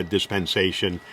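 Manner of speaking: ordinary talk, nothing marked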